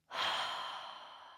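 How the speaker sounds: long breathy exhale; settling sigh. Real, not acted. Settling in after warming up.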